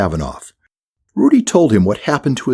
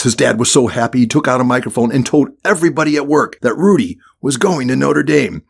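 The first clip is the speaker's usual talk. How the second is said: ordinary delivery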